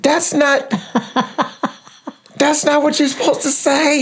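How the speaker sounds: high-pitched comic objection; exasperated protest. Retold or imitated. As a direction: protest in a squeaky, amused dismay. Dad protests mother's reply, laughing.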